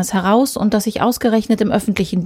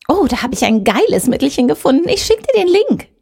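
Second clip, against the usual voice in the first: calling out; 6 semitones higher, 3 dB louder than usual. Acted. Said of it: "rief Susan".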